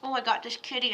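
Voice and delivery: usual speaking voice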